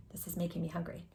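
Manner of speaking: usual speaking voice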